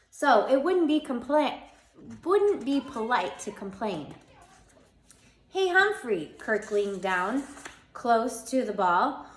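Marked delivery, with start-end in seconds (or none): 5.5-6.4 high, louder hey; boy calling out. A narrator's reading. Boy leaning down to the hamster, high and loud. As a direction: call out to him, pitched up.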